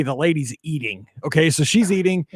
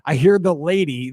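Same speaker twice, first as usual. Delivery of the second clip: ordinary delivery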